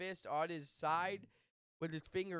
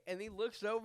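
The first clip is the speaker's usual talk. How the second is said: ordinary talk, nothing marked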